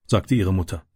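plain reading voice

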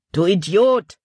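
a bandit shouting at his partner; bandit's outcry. A narrator's reading. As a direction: throw the insult out loud. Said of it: "rief der andere Bandit aus".

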